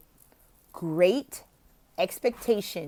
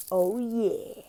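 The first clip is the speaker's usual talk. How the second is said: pretend cool; 2 semitones higher than usual. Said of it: "So you pretend to be cool".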